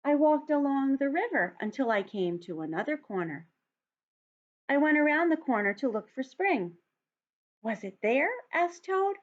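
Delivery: put-on lively read-aloud for young children; story narration. A narrator's reading. Teacher reads picture book aloud.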